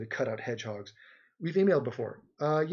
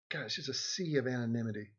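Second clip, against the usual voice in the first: usual speaking voice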